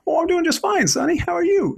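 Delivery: put-on old man voice. Old man greets his young neighbor, high old-timer voice. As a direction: play the cheerful old man on his porch.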